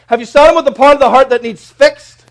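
loud, high, ringing sermon voice; thundering pulpit delivery; full-force preaching. Acted. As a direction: proclaim it to the whole church.

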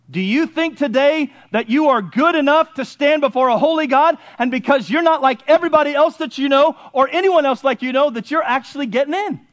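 urgent pulpit questioning; challenging preaching. Acted. Preacher asking who is good enough for God, challenging preaching. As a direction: press the congregation hard in one long question.

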